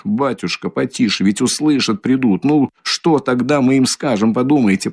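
plain reading voice

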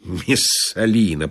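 slow, low note of disgust; disgusted thought. A narrator's reading. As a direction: drag the name out with distaste. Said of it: "подумал я с отвращением".

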